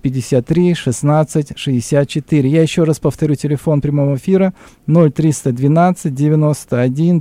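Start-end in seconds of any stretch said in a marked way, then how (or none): none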